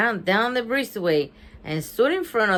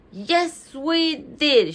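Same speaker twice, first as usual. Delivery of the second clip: higher-pitched, restrained yell; mild yell; 7 semitones higher, much slower than usual. A narrator's reading. Bean angry at her doubting friend, mild yell. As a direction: read a yell without much volume. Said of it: "she yelled".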